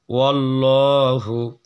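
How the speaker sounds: "ওয়াল্লাহু" slow, with a dark rounded L; full heavy lam. Shown as a demonstration. Teacher modelling Allah's name, "ওয়াল্লাহু" with full lam. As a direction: thicken and hold the "ল্লা" in "ওয়াল্লাহু". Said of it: "লামটাকে পুর করবো".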